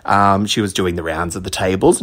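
usual speaking voice

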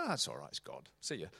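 low, soft, dismissive reply; offhand brush-off. Retold or imitated. Staff member answering a crying visitor, offhand brush-off. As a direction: toss it off casually, dropped low. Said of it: "How pastorally sensitive he was".

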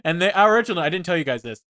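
ordinary talk

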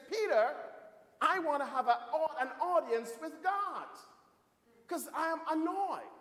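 high-pitched, indignant complaint; annoyed. Acted. Drowned man demanding to see God, high and annoyed. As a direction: raise the voice in irritation at the gate. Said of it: "And he's angry".

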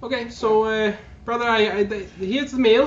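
high, loud sister greeting her brother; villainess sister. Acted. Sister presents the meal to her brother, high and bright. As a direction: sweetly offer the meal up high.

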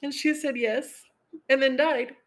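high, strained voice holding back tears; real grief breaking through; emotional. Real, not acted. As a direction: push through the story while tearing up. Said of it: "this is hard".

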